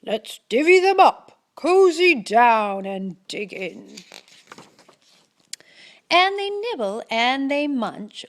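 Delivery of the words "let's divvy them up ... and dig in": louder badger voice with a grin; grinning badger. Badger grins while offering honey nuts to friends. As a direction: offer the treat gleefully. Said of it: "Badger says with a grin".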